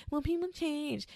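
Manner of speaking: high, breezy mock of a naysayer; mocking quote. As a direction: toss it off like a know-it-all. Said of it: "hear me and be like".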